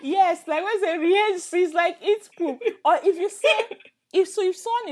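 high, amused talk through laughter; laughing. Real, not acted. Teacher still giggling over a curse word, laughing speech. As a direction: explain while still laughing.

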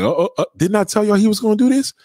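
high, loud told-you-so; excited gloating. Real, not acted. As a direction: crow over being right. Gloating that he predicted it.

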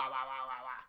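playful imitated swooshes of the axes; mock axe noises. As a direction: go "wah" with each swing of the double axes. Player mimics axe swings.

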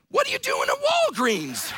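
very high, incredulous mock outburst; comic high-pitched indignation; bewildered grandfather. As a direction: squawk it like a baffled old grandpa. Grandfather's indignant punchline in story.

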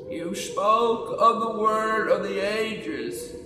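slow, high creaky elder voice; creaky starts. A narrator's reading. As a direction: speak as an ancient creaky dwarf. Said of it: "said the creaky voice".

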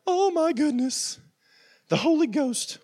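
high, drawn-out gasp of a nervous churchgoer; high-voiced, hammed-up dismay; mock alarm. Voicing freaked-out listeners' reaction.

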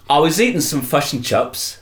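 put-on Kiwi accent on fish and chips; best New Zealand accent. Crime boss telling his story, New Zealand accent. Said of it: "that's my best New Zealand accent".

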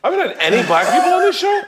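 mock outraged shout. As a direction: shout it up high in mock protest. Repeats the complaint, then louder.

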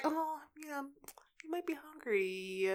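whispered, sympathetic aside; soft whisper. Retold or imitated. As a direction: whisper it as if thinking kindly. Imagined sympathetic thought.

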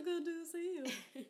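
squeaky high put-on greeting; silly high voice. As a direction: chirp it way up high.

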